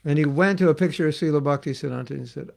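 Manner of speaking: normal talking voice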